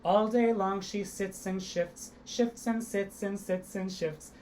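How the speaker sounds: slow, crisp sits and shifts; slow tongue twister. Shown as a demonstration. Teacher demonstrates the tongue twister slowly and crisply. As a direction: articulate every sound slowly. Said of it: "Here's the tongue twister".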